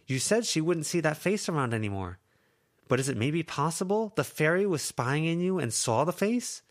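Lucy's voice. Acted.

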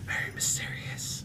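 secretive unvoiced hush; mysterious whisper. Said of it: "I like to whisper".

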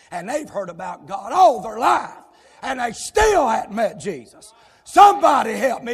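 preacher's voice climbing to a shout; rising shout. Real, not acted. Preacher to church, climbing into a loud shout. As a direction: build it up and call out to the congregation.

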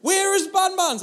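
put-on high, loud child voice insisting; demanding little girl. Daughter demanding her toy from Dad, high loud child voice. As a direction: she wants the toy now. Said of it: "She's like".

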